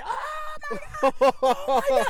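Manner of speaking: very high mock shriek; imitated panicked scream. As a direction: screech like a fleeing crowd. Crowd fleeing the crash, acted.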